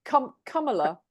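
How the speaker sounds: "Kamala" with a u sound, KUM-uh-luh, falling; pronunciation practice. Shown as a demonstration. Host copying the guest's pronunciation, "Kamala" as KUM-uh-luh. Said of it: "like with a U".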